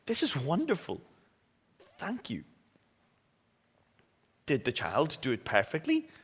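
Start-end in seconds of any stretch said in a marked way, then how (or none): 0.1-2.4 sincere praise. Pretend. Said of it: "they would say".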